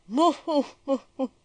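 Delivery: loud, high siren-like howl. Acted. German police dog howling loudly like a siren. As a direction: wail it out like a siren. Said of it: "he howled like a siren".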